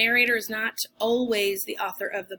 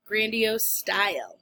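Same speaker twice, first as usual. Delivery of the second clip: ordinary talk, nothing marked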